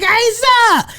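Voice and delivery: high call of a name; high-pitched mock call; wife calling out. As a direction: mimic her shouting his name. Wife calling him again.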